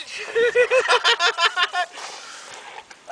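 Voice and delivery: high, long cackle; real laughing fit. Real, not acted. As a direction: crack up helplessly at your own story. Cracks up at own story.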